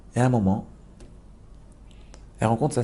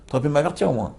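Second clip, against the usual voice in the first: upset reproach; 7 semitones higher than usual. Acted. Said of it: "il était énervé".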